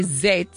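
"Z" said "zed"; South African letter name. Shown as a demonstration. Coach describes baffling Americans, "Z" as "zed". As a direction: rhyme "Z" with "bed". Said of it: "when we say Z".

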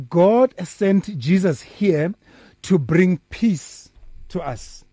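slow, deliberate preaching; slow emphatic. Real, not acted. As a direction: spell it out word by word.

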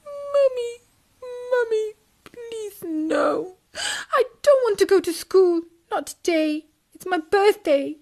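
put-on high crying child begging; tearful pleading. Tadpole begging his mother, crying. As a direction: plead through sobs. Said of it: "heard little Tim's sobs".

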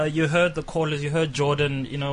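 usual speaking voice

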